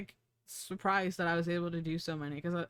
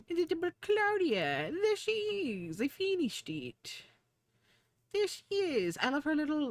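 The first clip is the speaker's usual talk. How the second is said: doting squeal; 9 semitones higher than usual. Real, not acted. Streamer revealing finished Claudia model, doting squeal. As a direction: coo it high with delight. Cooing over finished cloud character.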